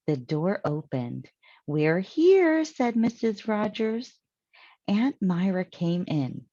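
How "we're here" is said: high, loud announcing call; cheerful arrival call. Acted. Missus Rogers, arriving home, calls out high and loud. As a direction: call out brightly at the door.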